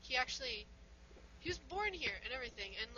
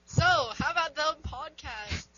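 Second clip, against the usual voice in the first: loud, slow, raised-pitch opener; podcast intro; 5 semitones higher, 9 dB louder, slower than usual. Shown as a demonstration. Co-host pitching a show opener, loud drawn-out intro. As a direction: draw it out big for the audience. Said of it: "this is how we should intro".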